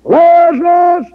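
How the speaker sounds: high, loud drawn-out call. Retold or imitated. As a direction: call the name across a graveyard. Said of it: "false cults out in that cemetery saying".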